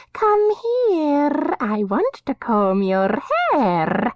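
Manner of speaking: very high, strange voice for the madwoman Dirna; high eerie madwoman voice. A narrator's reading.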